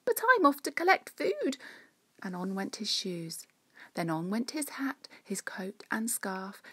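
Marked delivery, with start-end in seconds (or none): 0.0-1.6 high, loud little critter voice; eager small squirrel; Cyril's voice. Acted. Cyril answers the queen.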